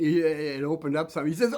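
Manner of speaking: plain reading voice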